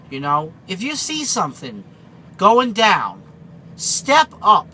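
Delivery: loud, emphatic rallying call; preaching. Acted. Trucker urging his viewers to step up, preaching loudly. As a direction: drive the message home to viewers.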